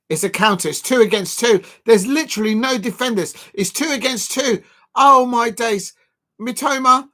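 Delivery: loud, rising panic at a counterattack; alarmed live commentary. Real, not acted. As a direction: call it out as the attack unfolds. Live counterattack against his team.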